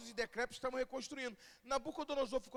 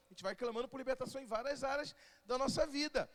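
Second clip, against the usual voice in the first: ordinary talk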